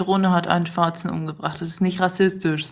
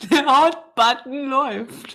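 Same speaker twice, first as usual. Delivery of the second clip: laughing; 5 semitones higher, slower than usual. Real, not acted. Laughs while joking.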